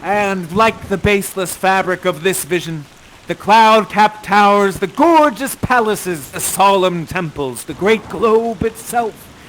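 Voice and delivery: solemn, swelling stage declamation; Prospero's farewell speech. Acted. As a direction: deliver it grandly, then let it fade.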